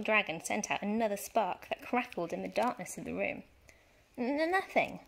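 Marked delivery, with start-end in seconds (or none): 4.2-5.0 put-on higher, halting boy's stammer. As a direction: trip over the first sound, caught out. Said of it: "I stammered".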